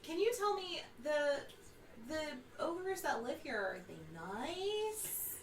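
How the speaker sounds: high, slow questioning voice; helium voice. Pretend. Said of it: "get on your chipmunk voice".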